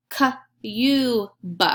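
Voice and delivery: K-U-B as separate sounds; sounding out words. Shown as a demonstration. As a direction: sound out K-U-B one by one. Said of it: "Let's try it".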